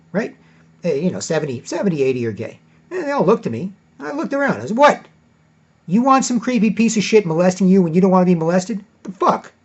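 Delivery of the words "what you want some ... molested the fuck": loud, high, indignant re-enacted outburst; angry challenge. Man to a staring party crowd after freaking out, re-enacted angry challenge. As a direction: round on the onlookers, loudly outraged.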